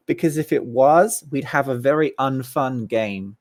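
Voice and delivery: normal talking voice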